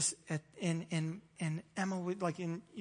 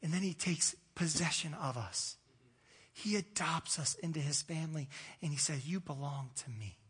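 softer, lower, intimate; tender preaching; 4 semitones lower, 5 dB quieter than usual. Acted. Pastor telling church they belong to God, tender and soft. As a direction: lean in and speak it gently.

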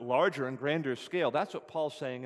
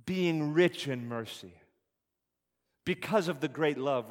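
scripture read aloud; 4 dB louder than usual. Acted.

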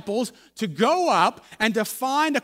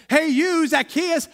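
raised, higher call to someone above; acted friendly summons; 5 semitones higher, slower than usual. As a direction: hail him up in the tree. Jesus calls up into the tree.

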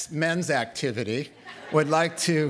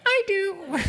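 mock eager volunteer; 3 dB louder than usual. Mocking a man in the crowd.

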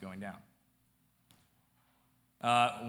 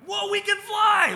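joyful shouted cry, pitched way up and much louder; mock scream; 13 dB louder than usual. A comedian screaming in wonder mid-flight, shouting way up high. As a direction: yell it like a thrilled passenger in the sky. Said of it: "we should just be screaming".

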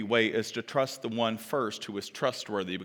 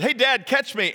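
high, raised, quick call; child calling out; 10 semitones higher, 7 dB louder than usual. Acted. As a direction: imitate an eager kid calling Dad. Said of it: "above me yell".

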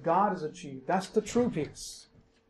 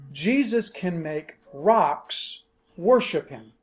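ordinary delivery

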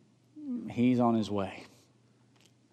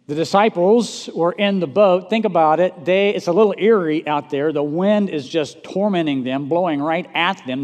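louder, vivid, building the storm scene; dramatic storm telling; 5 semitones higher, 8 dB louder than usual. Real, not acted. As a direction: paint the storm with a raised voice.

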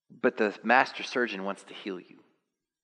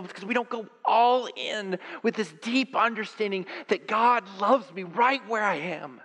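impassioned preaching; 12 semitones higher than usual. Acted. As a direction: preach it high with urgency. Rising preaching.

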